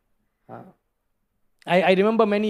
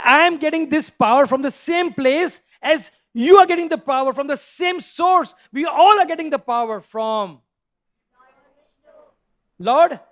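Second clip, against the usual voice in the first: put-on bold child answer; 6 semitones higher, 5 dB louder than usual. Prahlad answers boldly.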